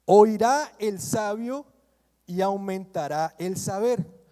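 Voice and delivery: loud, slow proclamation of the verse; preacher solemnly declaring the Proverbs verse aloud; scripture reading. Acted. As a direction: read the verse out like a preacher from the pulpit. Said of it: "Veamos lo que dice Proverbios".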